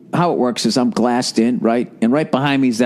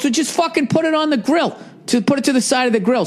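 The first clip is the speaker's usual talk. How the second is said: higher-pitched mock impatient instruction; irritated cook; 13 semitones higher than usual. Chef telling narrator where to put the burger, mildly irritated high voice. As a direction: play the annoyed chef barking orders lightly. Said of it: "He goes".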